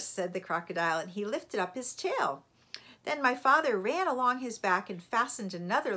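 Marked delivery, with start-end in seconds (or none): none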